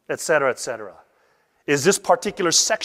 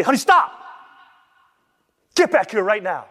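urgent shout; 4 semitones higher than usual. Retold or imitated. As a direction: yell to stop a child in the street. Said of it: "You would say".

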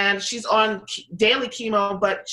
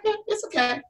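unimpressed shrug; 11 semitones higher, 4 dB quieter than usual. Retold or imitated. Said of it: "They're like".

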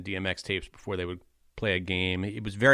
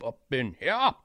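higher-pitched, badly faked Russian accent; attempted Russian accent; 5 semitones higher, slower than usual. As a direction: mimic a Russian dad rapping, hint of accent. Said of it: "that's me trying to do a ... Russian accent".

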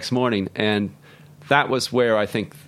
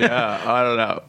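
slow, laid-back Vermont voice; Vermont accent; slower than usual. Pretend. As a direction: lean into a relaxed rural Vermont accent. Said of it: "I'm trying to do my old Vermont, um, my Vermont voice".

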